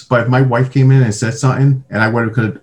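usual speaking voice

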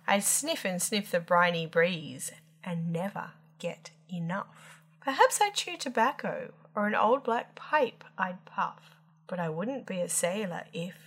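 ordinary delivery, nothing marked

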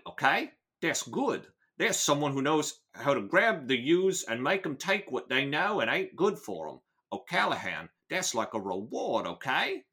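put-on hammy amateur London accent; Michael Caine. Gang boss explaining the reward to his captive, mock Cockney. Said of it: "lean a little bit toward Michael Caine".

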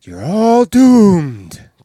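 very slow, loud doomsday warning; slow ominous raspy drawl. Retold or imitated. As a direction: drag out the doom. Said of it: "he says".

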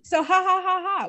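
high mock laugh; forced fake laugh. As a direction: fake laugh to cover a flop. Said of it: "I have to then laugh".

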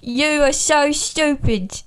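slow put-on English butler; Michael Caine. Guest as the butler answering Batman, slow British voice. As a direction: draw it out in a British impersonation. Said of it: "I think it's Michael Caine".

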